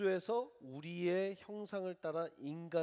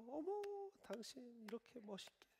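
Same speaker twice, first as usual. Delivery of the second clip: much higher, surprised swoon; delighted gasp; 10 semitones higher, 8 dB quieter than usual. Pretend. Wife receiving a car key from her husband, delighted gasp.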